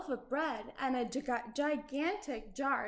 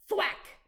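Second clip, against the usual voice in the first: axe thwack sound; 7 semitones higher than usual. A narrator's reading. Axe hits beanstalk.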